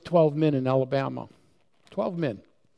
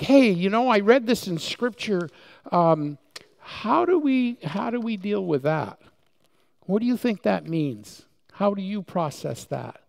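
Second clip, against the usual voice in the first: much higher, bright and eager; eager men talking Scripture; 6 semitones higher, 4 dB louder than usual. Retold or imitated. Preacher voicing the men from Alabama, eager and higher. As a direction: ask the questions with eager curiosity. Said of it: "But it was more like".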